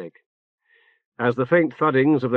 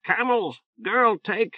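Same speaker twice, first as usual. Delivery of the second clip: bird voice; 10 semitones higher, slower than usual. A narrator's reading. Talking bird continues message.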